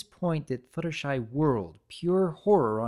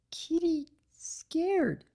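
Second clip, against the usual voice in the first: very high, slow, small and frightened; scared little creature; much slower than usual. Acted. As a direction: shrink into it, scared and tiny. The cookie says it's scared.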